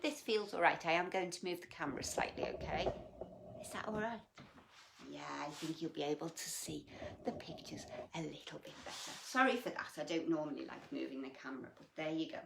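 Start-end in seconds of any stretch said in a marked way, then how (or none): 3.6-9.1 whispered aside. Real, not acted. Adjusting camera aside.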